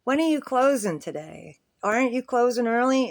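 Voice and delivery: put-on loud pointed question for the old man; says it out loud. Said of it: "she says out loud".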